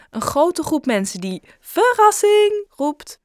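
high, drawn-out party "verrassing"; mock surprise shout.

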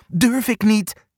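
high, scared little voice; timid hare voice. A narrator's reading. Angsthaas won't climb, high frightened voice. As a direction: squeak out the refusal. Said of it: "zegt Angsthaas".

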